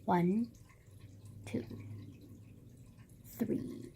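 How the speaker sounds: very soft, halting count; tally under your breath with long pauses; quiet, absent-minded counting; counting to oneself. Real, not acted. Counting Uber rides to herself.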